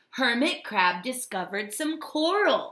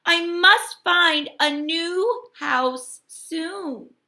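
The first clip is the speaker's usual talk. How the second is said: slow, raised resolve; determined Hermit Crab; 7 semitones higher, 5 dB louder, slower than usual. A narrator's reading. Crab resolving to find a house, slow and raised. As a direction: settle it firmly and slowly. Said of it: "he thought".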